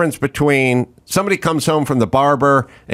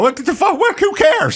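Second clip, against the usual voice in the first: mock outrage. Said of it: "I couldn't go".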